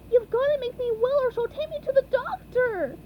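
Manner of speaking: high, fast, loud yell. A narrator's reading. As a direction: yell in panic. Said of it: "yelled SpongeBob".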